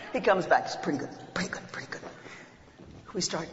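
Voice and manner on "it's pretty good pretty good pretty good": unvoiced hushed whisper. Father sneaks back into the cinema whispering to his son. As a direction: breathe it out under your breath.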